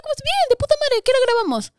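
overly upbeat hello masking grief; fake cheerful greeting. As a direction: chirp it to hide the grief. Faking cheer after father's death.

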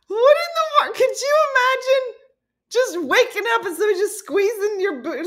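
very high, squealed through laughter; helplessly cracking up in disbelief; incredulous laughter. Real, not acted. Imagining waking up to being squeezed, incredulous laughing.

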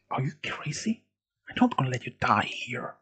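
soft, urgent disbelief; hushed protest. A narrator's reading. Milo refusing to let Andy die, hushed. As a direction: keep the objection under your breath. Hushed reply to wounded friend.

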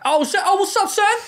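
high, loud, excited hey-there squeal; excited J B Smoove greeting. Retold or imitated. Friend spotting him on set, squealing hello. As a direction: burst out happy to see him, way up high.

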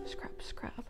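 whispered scrub scrub. Acted. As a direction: breathe it out softly. Whispered song refrain.

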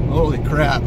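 high, loud amazed burst; surprised exclamation. Real, not acted. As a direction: blurt it out, thrilled and stunned. Second burst after throttle tap.

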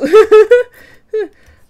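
loud high giggling burst; burst of laughter. Real, not acted. Artist bursting out laughing at her own idea. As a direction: crack up excitedly.

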